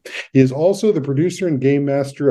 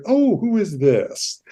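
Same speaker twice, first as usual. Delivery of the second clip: slow, higher, curious "oh, who is this?"; surprised; 7 semitones higher, slower than usual. Retold or imitated. Host recalling first seeing the guest, intrigued surprise. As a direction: draw it out like spotting someone intriguing. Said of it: "It's like".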